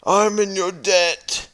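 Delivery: high, slow, earnest mock vow; solemn gratitude. Rescued man vowing thanks, slow mock-solemn. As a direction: vow it grandly. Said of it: "And he's like".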